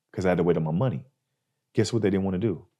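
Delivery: ordinary talk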